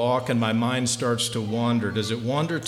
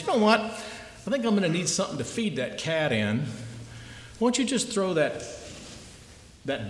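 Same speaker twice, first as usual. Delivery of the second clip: higher, offhand shopkeeper; shop owner; 6 semitones higher than usual. Acted. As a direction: toss off the request like an afterthought. Shop owner asks for the bowl.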